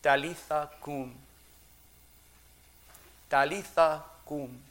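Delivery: Aramaic "Talitha cum", stressing Talitha with a falling end; Aramaic words. Retold or imitated. Preacher voicing Jesus to the little girl, Aramaic "Talitha cum". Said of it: "keeps this in the original Aramaic that Jesus spoke".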